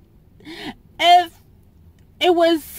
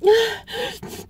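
tearful sigh; 6 semitones higher than usual. Real, not acted. Upset vlogger sighs.

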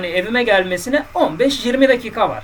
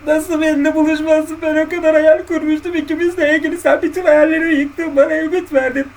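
high, louder, hammed-up weepy whine; mock crying; 9 semitones higher, 6 dB louder than usual. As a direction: wail it like a jilted man mocking his tears. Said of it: "Ha, ağladın yani".

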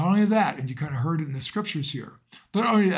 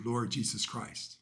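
usual speaking voice

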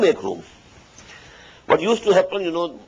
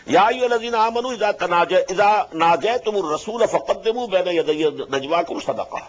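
measured chanted Arabic recitation; Quran recitation; 5 semitones higher, much faster than usual. Acted. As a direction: intone the verse as in tilawa.

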